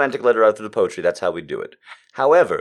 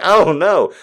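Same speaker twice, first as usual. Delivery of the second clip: mock dismay; 6 semitones higher, 7 dB louder than usual. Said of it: "And it's like".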